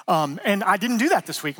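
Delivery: ordinary delivery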